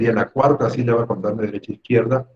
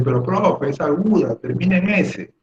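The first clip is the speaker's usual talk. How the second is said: a touch higher, lightly voiced student objecting; mock student whine, a bit higher; imagined student protest; 7 semitones higher than usual. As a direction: play the pupil protesting to the teacher. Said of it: "porque tú me vas a decir".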